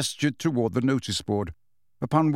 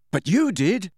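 higher, accusing protest; mildly angry objection; 7 semitones higher than usual. A narrator's reading. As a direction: throw it back at him, pitched up. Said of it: "said Harry angrily".

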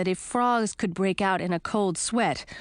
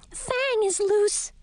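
shouting; 13 semitones higher, much slower than usual. Acted. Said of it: "shouted Louise".

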